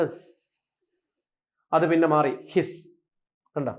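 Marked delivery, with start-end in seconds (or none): 0.0-1.3 long, voiceless snake hiss. Shown as a demonstration. Teacher showing students the sound behind the word, long snake hiss. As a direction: imitate a snake with a sustained sss. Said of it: "പാമ്പ് ചീറ്റുമ്പോൾ ഉള്ള sound".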